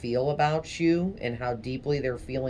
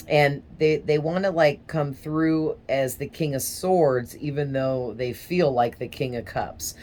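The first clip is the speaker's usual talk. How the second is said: normal talking voice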